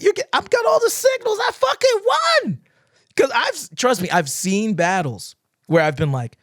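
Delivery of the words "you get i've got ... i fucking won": mock triumphant. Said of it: "you're like".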